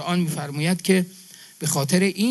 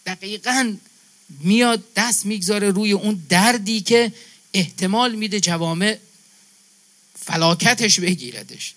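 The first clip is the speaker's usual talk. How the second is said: normal talking voice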